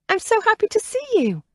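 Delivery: bright, joyful relief at a reunion; happy greeting. A narrator's reading. Greeting a relative with joy.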